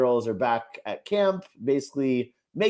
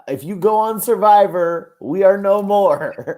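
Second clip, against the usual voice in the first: dramatic; 6 semitones higher, 8 dB louder than usual. Pretend. Imagined breakup ultimatum.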